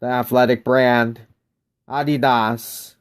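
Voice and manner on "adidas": Adidas drawn out as AH-dee-dahs; slow German-style Adidas, AH-dee-dahs; German pronunciation. Shown as a demonstration. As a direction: say Adidas slowly with a long final dahs. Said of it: "pronounced differently".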